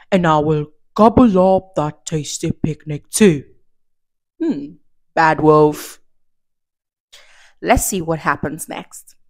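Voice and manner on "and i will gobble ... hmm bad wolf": lick your lips over it; sly wolf voice. Acted. Wolf voice continues.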